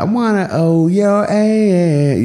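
hammed-up British talking voice; mock British accent. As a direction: say it the way a Brit would talk it. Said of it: "then they don't say".

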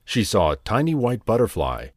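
ordinary delivery

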